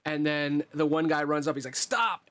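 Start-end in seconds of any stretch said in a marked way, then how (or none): 1.8-2.3 high-pitched cry to halt; acted urgent shout. Man running up at the attacker, urgent shout.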